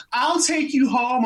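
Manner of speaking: high-pitched imitation of a lady; woman in audience. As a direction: call out an offer like a female spectator. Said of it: "this lady was like".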